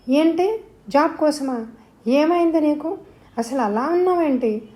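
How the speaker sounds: corner her with questions; demanding answers. A narrator's reading. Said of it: "Mohita నిలదీసింది". (గట్టిగా నిలదీస్తూ)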